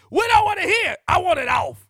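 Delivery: shouting like a fired-up preacher; preaching at full force. Acted. Preacher hollering at the congregation, full-force shout. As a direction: thunder it from the pulpit. Comedic preaching rant opening.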